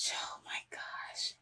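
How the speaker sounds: barely audible, slow gasped whisper; stunned whisper. Real, not acted. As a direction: whisper it in shock. Stunned by show.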